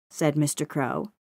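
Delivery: ordinary delivery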